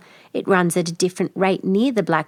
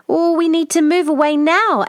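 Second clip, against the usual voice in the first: high, loud panicked stammer; alarmed stammer; 9 semitones higher, 5 dB louder than usual. Acted. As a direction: blurt it out in a panic. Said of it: "Ava stammers".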